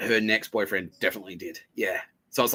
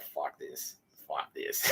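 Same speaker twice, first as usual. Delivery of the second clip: low, very soft mutter to yourself; muttered resignation; 8 semitones lower, 12 dB quieter, much slower than usual. Retold or imitated. Sitting alone listening to music, muttered disgust. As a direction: grumble it under your breath.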